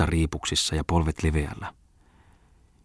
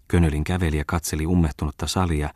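ordinary delivery

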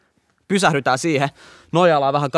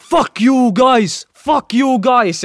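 high, loud, drawn-out yell; slow mock bellow, pitched way up; retold yell in English; 8 semitones higher, 5 dB louder, much slower than usual. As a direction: imitate an enraged driver hollering. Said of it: "ja se huutaa englanniksi".

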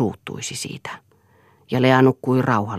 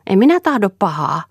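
put-on higher, louder, anxious girl's plea; 8 semitones higher, 6 dB louder than usual. A narrator's reading. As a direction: plead hurriedly, a touch scared. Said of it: "näytti taas hätäiseltä". (hätäisesti, pelokkaasti anoen)

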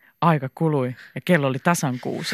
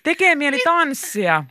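high, stretched-out shout; singled-out high exclamation; calling out; 10 semitones higher, slower than usual. A narrator's reading. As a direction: call it out eagerly, pitched up. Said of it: "Liisa huutaa".

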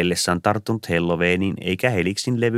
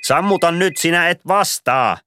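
raised, high command, last words slowed; Santa's sharp order; 11 semitones higher, 5 dB louder than usual. A narrator's reading. Santa ordering Ilari to silence his ringing phone, raised and high. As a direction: snap at him to switch it off.

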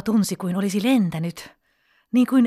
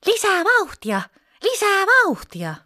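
high, joyful cry for more; gleeful child's shout; 12 semitones higher, 3 dB louder than usual. A narrator's reading. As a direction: call out high with delight, like a child on a swing. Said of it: "nauroi riemuissaan ja huudahti".

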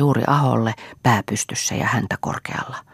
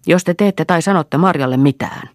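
louder and higher, threatening shout; shouting threat; 6 semitones higher, 5 dB louder than usual. Acted. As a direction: yell it across the yard at the storehouse door. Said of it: "huutaa hän aitan ovelta".

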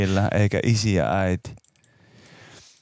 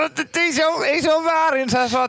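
higher, agitated protest; exasperated; 10 semitones higher than usual. Real, not acted. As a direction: say it's fine while clearly not fine. Agitated reaction, high far voice.

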